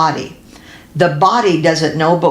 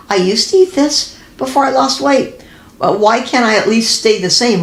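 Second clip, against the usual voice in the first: exasperated whine; 6 semitones higher than usual. Retold or imitated. Frustrated dieter's inner voice.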